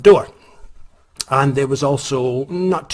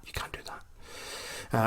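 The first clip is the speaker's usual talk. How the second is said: slow, whispered warning; hushed warning; 16 dB quieter, much slower than usual. Retold or imitated. Bartender whispering a warning to the late customer. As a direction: breathe it out slowly like a grave secret.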